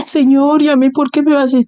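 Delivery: high, quick whining objection; whiny protest. Retold or imitated. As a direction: complain to God like a stubborn child. Believer protesting to God.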